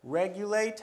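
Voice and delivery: "Regulate" stressed on REG; high, strong first syllable in "Regulate". Shown as a demonstration. Teacher showing the stress rule, stressing REG in "Regulate". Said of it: "that's where the stress falls".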